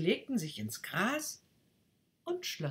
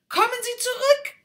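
much higher, loud call; shouting; 12 dB louder than usual. Acted. Rocket shouts after the departing duck, high and loud. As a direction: call her back. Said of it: "schrie die Rakete".

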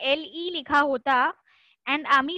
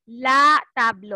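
"ला" louder and slow; stress on ला; 6 dB louder, slower than usual. Shown as a demonstration. Teacher stressing "ला" to the class. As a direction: punch "ला" in "ला table". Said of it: "the table".

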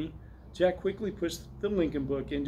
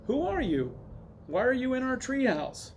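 put-on high-pitched demanding boy; shouting boy; 9 semitones higher than usual. A narrator's reading. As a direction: challenge the strangers in a raised pitch. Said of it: "the boy shouted when he saw".

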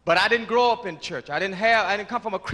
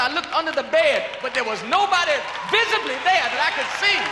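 much higher, louder ecstatic testimony; preaching at full force; 9 semitones higher, 4 dB louder than usual. Real, not acted. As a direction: lift it into praise, hallelujah. Testimony rising into praise.

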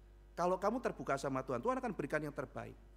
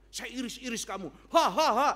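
high put-on threat into loud staged laughter; mock villainous gloating; 6 semitones higher, 8 dB louder than usual. Hater threatening to slice someone up, mock evil gloating. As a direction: play a hammy villain, ending in a big fake laugh. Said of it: "logikanya kayak begitu".

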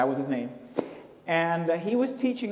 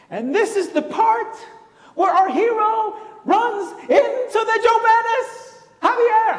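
loud, high, hammed-up professor reading aloud; mock excited professor; 7 dB louder, slower than usual. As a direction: boom it out dramatically, slow and high-pitched. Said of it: "And Professor Murphy goes".

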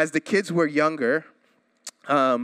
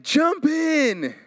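much higher, coaxing call; eager new dad; 11 semitones higher than usual. Retold or imitated. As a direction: cheer on tiny kids by the pool. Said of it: "I'm like".

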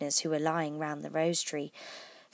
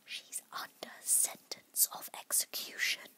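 very soft, slow whispered secret; hushed, confidential warning; 12 dB quieter, slower than usual. A narrator's reading. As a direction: whisper it close to the ear. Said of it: "and whispered".

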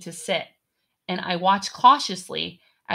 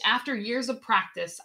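ordinary delivery, nothing marked

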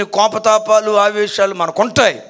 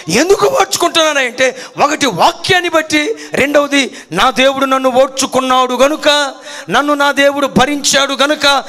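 louder, higher preaching that rises to a shout; full preaching; 5 semitones higher, 4 dB louder than usual. Acted. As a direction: preach it hard to the congregation. Preaching on forbearance. (బలంగా ప్రసంగిస్తూ)

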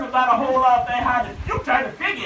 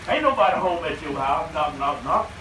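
low, dropped mocking aside; teasing aside; 10 semitones lower, 3 dB quieter than usual. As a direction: drop right down low to tease. Mocking an empty-headed listener.

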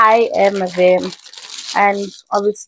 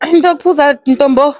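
calling out; 5 semitones higher, 5 dB louder, slower than usual. Real, not acted. Calling out to her friend.

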